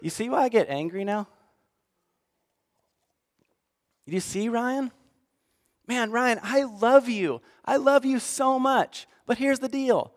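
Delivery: lightly voiced God, tender and hurt; God's tender voice. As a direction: gently plead as a loving God. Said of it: "God was starting to say".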